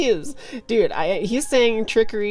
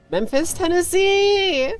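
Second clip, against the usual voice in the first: excited shout; 11 semitones higher, 5 dB louder, slower than usual. Real, not acted. Random exclaimed outburst.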